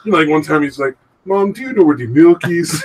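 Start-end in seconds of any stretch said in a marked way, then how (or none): 1.2-2.8 higher, louder mock meme accent; Ugandan Knuckles accent. Asking mom for the milk, accidental Knuckles accent. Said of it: "he would accidentally do the fucking accent".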